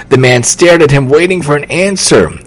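ordinary delivery

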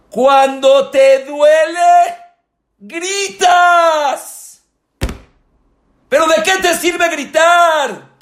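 high, loud, slow pulpit shout; full force preaching. Acted. Rabbi showing how pain makes one cry out, shouting.

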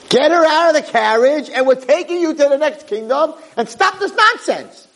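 very high-pitched mock yelling; acting out panicked advisors in a high voice; outraged advisors' yell. Advisors protest the king's wish.

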